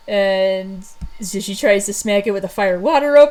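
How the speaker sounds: ordinary talk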